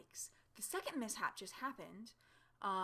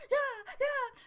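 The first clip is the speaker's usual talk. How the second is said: bike-riding noise; 9 dB louder than usual. Shown as a demonstration. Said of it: "apparently I do this when I ride".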